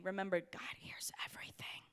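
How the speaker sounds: hushed whispered aside; preacher whispers a reminder to the room. Real, not acted. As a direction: lean in and whisper it. Aside to congregation.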